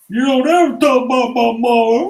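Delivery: put-on high, loud Water Boy yell; Water Boy impression. Teammate fires back at the trash-talker, high loud Water Boy yell. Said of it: "Sound like freaking the water boy".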